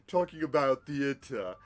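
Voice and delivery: overly grand English lecturer voice; pompous English accent. Retold or imitated. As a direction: ham it up like a snooty English theater buff. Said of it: "this dripping, oozing, pompous accent".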